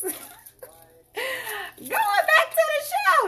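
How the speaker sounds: laughing high and slow, drawn out. Real, not acted. Host laughing at herself before resuming the sale, laughing. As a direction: wind down the laugh back to business.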